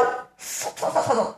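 轻声吹气学飞跑的嗖嗖声; 不出声带，只用气息嗖嗖; 气声嗖嗖. Acted. 模仿奔跑声. (轻声气音模仿疾跑声)

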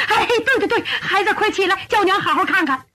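high, eager motherly fussing; flustered joy. Acted. Mother telling her kneeling son to rise, flustered joy. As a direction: urge your child up, voice pitched high.

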